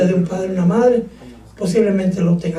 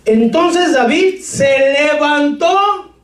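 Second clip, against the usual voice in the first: raised, slow solemn Bible reading; scripture reading; 7 semitones higher, slower than usual. Acted. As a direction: read the verse aloud with gravity. Preacher reads scripture aloud.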